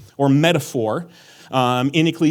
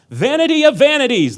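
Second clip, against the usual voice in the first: high, loud proclaiming shout; yelled; 10 semitones higher, 6 dB louder than usual. Shown as a demonstration. Preacher yelling the verse at the congregation. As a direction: yell it like the exclamation point demands. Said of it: "There's an exclamation point, so you gotta yell that".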